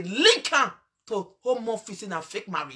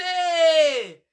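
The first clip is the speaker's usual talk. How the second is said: mock cheering shout; 5 semitones higher, 6 dB louder than usual. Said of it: "They say".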